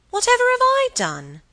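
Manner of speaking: very high, loud bewilderment; indignant protest. Acted. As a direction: protest your innocence, voice shooting up. Helen protests at being scolded.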